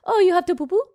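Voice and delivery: high, vaguely French lilt; undefined accent. Retold or imitated. Parent to the baby going to the bathroom, vaguely French accent. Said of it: "undefined accent".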